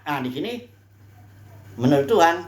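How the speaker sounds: normal talking voice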